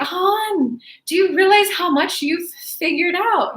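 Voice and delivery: grandma amused at her granddaughter; teasing grandma. Retold or imitated. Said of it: "And she went".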